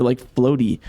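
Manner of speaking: ordinary delivery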